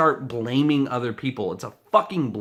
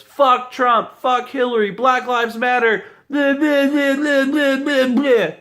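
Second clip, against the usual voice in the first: much higher, much louder mock shrieking; mock protester yelling; 13 semitones higher, 8 dB louder than usual. Ranter mocking protesters with a shrill yelling tantrum. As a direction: screech it like a tantrum. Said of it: "and all you did was".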